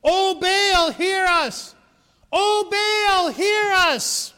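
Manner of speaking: high, loud desperate cry to a god; frantic crying out. Acted. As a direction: beg the idol at the top of your voice. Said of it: "this is what they said".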